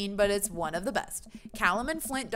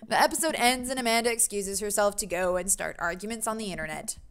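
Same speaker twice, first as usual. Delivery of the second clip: usual speaking voice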